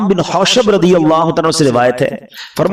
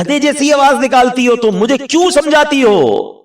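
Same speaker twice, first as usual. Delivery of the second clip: high, scornful retort at the mother; insolent son; 9 semitones higher than usual. Retold or imitated. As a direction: sneer it back at her like a rude son. Drunk son mocking his mother. (بدتمیز بیٹے کا طنزیہ، اونچا جواب)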